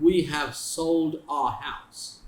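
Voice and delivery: very slow, spelled-out example sentence. Shown as a demonstration. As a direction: say each word slowly for the class. (बहुत धीरे, शब्द-शब्द करके)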